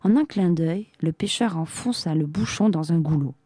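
plain reading voice

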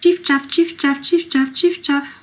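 high, loud, evenly ticking bird chirps; pouillot véloce call. Acted. Poet mimics the pouillot's coin-counting song, high bird call. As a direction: chirp it high in a steady two-note beat. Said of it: "Il dit".

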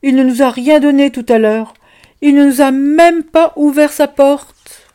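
put-on loud, high, fast child's grievance; indignant child. Orphan child complaining Boustru gave nothing, loud and high. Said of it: "dit un des enfants".

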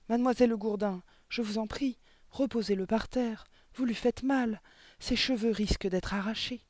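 high, urgent pleading; crying out. A narrator's reading. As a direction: beg for the child to be released. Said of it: "mademoiselle Candy s'écria". (supplication alarmée, voix aiguë)

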